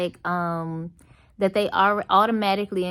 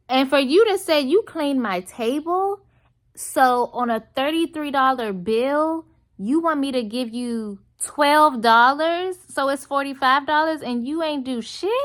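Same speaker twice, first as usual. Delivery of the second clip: indignant rant; 6 semitones higher, 4 dB louder than usual. Real, not acted. Ranting at waitress's claim.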